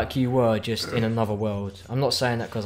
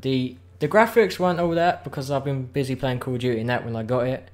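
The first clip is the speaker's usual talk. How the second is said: usual speaking voice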